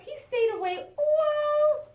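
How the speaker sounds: high, slow wailing chick voice. Acted. Chick telling her story, drawn-out and loud. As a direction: stretch it out with a little wail.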